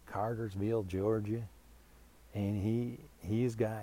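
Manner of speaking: ordinary talk, nothing marked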